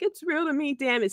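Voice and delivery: mock tearful insistence; crying fan meme. Quoting the crying wrestling fan in a lightly tearful voice. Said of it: "gif that we see of the guy crying".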